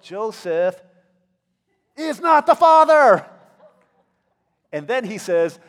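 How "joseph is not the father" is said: high, loud, drawn-out announcer reveal; big, slow, high game-show reveal; talk-show reveal. Acted. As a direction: belt it like a daytime TV host reading a paternity result. Angel as talk-show DNA reveal.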